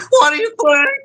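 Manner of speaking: comic high-pitched cartoonish voice. Shown as a demonstration. Guest showing host the voice that goes high, high-pitched and funny. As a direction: push it way up high for laughs. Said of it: "sometimes when I go high pitch".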